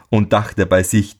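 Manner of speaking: ordinary delivery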